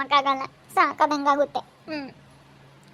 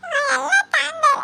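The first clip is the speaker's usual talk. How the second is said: put-on high teary child; 10 semitones higher than usual. A child tells her mother about the sweets, teary and pleading. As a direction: describe the sweet through tears.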